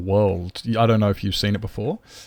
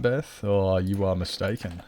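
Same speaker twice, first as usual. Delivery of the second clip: ordinary delivery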